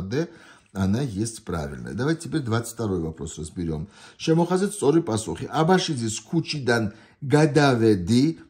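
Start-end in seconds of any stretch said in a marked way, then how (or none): 4.2-8.4 fast test-item reading. Shown as a demonstration. Teacher reading a Georgian test item fast. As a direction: rattle off the Georgian line quickly.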